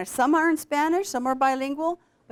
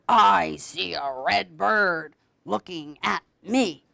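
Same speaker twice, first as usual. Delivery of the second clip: bear voice; 6 semitones lower, slower than usual. Acted. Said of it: "bear answers in the book".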